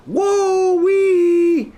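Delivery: high delighted whoop; excited whoop. Real, not acted. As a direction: whoop it out, thrilled. Gamer whoops at stream alert.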